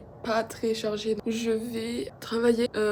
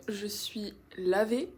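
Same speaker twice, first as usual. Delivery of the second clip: ordinary talk, nothing marked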